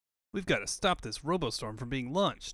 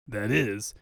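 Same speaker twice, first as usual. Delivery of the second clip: usual speaking voice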